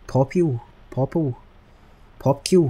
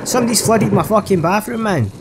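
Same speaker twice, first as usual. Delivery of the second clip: high-pitched, raised-voice outrage; loud indignation; 9 semitones higher, 5 dB louder than usual. Real, not acted. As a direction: exclaim it loudly, pitch right up. Finds bathroom flooded in game.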